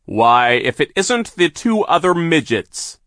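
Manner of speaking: slow, high, loud sneer; a gloating villain's voice. A narrator's reading. Villain foreman voiced in story.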